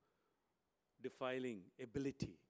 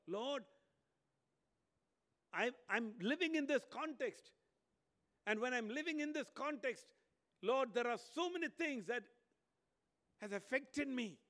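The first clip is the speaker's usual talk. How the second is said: much higher, louder pleading prophet; pleading Isaiah; 11 semitones higher, 7 dB louder than usual. Acted. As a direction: cry out to the Lord in confession. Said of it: "Isaiah immediately tells God".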